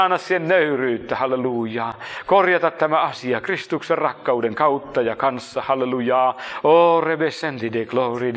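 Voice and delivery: ordinary talk, nothing marked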